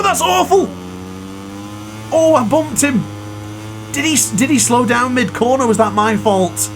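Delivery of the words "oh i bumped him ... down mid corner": ordinary talk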